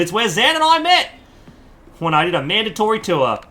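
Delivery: ordinary delivery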